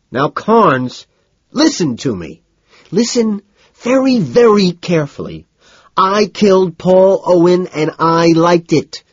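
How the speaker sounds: high, loud, emphatic confession; desperate insistence. A narrator's reading. Narrator makes Carnes listen to the confession, desperately insistent. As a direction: drive each word home loudly.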